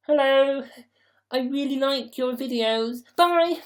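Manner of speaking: high, squeaky fan greeting; mock fan. Imagined fan greeting streamer after a long trip, mock high voice. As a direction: pitch it way up like a gushing fan.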